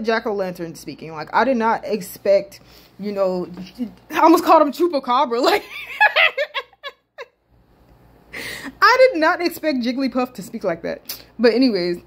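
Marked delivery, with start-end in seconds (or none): 5.7-6.6 high, helpless giggling; real laughing fit. Real, not acted. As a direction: crack up at your own slip. Cracks up at own slip.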